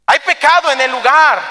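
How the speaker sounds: high, loud pronouncement; forceful declaration. Acted. God answering Joshua about sin in the camp, forceful. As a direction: proclaim the charge with preacher's force.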